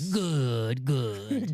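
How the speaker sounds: gloating dark lord, drawn out; Palpatine voice. Acted. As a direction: savor it like a scheming emperor. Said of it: "Palpatine in the house".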